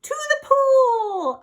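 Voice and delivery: high, loud crying yell. Acted. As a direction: scream it back. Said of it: "I yelled".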